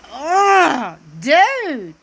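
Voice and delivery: fed up with Cody; exasperated ugh. Real, not acted. Disgusted at Cody's excuses.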